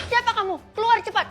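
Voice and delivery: high, loud scared yell at a stranger; girl frightened by a stranger at night, shouting. Acted. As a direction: yell at the intruder to get out, scared.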